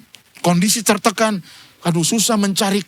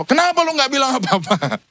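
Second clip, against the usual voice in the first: mock indignant friend; 9 semitones higher than usual. Retold friend's indignant question.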